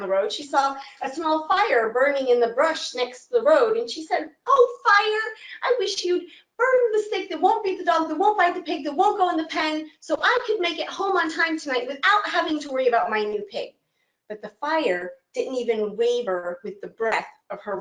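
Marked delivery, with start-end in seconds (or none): 4.5-13.7 put-on high old woman voice asking a favor; old woman pleading. Old woman asks the dog to bite her pig.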